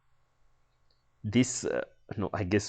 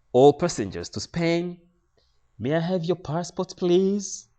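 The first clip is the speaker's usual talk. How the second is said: put-on high, loud Spanish-accented lady; announcing in a put-on Spanish accent; 10 semitones higher, 6 dB louder than usual. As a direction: imitate a Spanish airline lady, pitched up. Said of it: "another lady asks in a Spanish accent".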